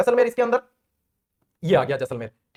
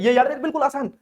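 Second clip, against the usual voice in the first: ordinary talk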